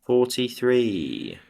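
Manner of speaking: slow drawn-out number call; bingo caller. Pretend. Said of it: "I really need to learn my bingo calls".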